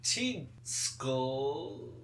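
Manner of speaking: each word flipped in order; backwards speech. Shown as a demonstration. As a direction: say it word by word reversed. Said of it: "There you go. You're Zatanna".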